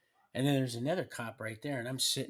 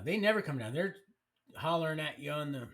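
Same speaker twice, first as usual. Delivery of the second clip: ordinary delivery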